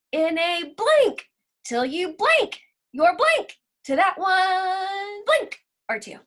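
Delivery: playful sing-song recital; template poem read. Acted. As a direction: read the blanks like verse. Reads template aloud as poem.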